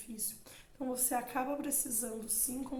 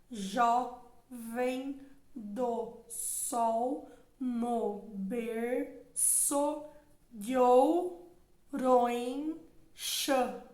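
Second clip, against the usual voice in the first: very slow, louder, each syllable pulled apart; counting syllables; 5 dB louder, much slower than usual. Shown as a demonstration. Teacher scanning the alexandrine for students, syllable by syllable. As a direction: stretch the verse out beat by beat to count it. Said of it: "Aqui nós temos versos alexandrinos, então olha lá".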